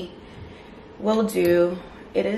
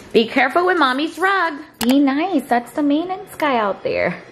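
higher, louder warning to a dog; scolding a pet; 7 semitones higher, 3 dB louder than usual. Real, not acted. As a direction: tell the dog off, sing-song.

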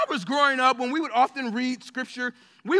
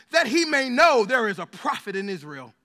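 measured, raised voice reading the Bible passage; scripture reading; 4 dB louder than usual. Acted. Pastor reading Second Kings to the congregation, solemn and raised. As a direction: read it out like holy text to the church.

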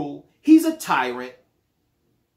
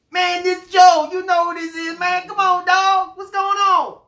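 much higher and louder, fake-friendly phone greeting; overeager cousin; 11 semitones higher, 7 dB louder than usual. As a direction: gush like a long-lost cousin after money. Acted phone call, cousin caller.

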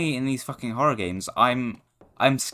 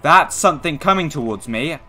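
louder, higher alarmed warning; panicked alarm; 5 semitones higher, 9 dB louder than usual. Real, not acted. A monster approaching him in the dark, panicked and loud. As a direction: sound the alarm as it nears.

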